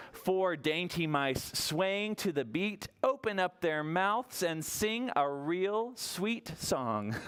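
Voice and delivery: ordinary talk, nothing marked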